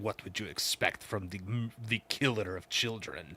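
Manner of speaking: lightly voiced Bane, low and soft; a hint of menacing Bane; Bane quote. Fan quoting Bane to friends, low and soft. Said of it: "when Bane's like".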